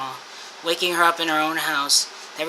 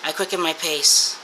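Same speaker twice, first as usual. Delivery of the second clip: ordinary delivery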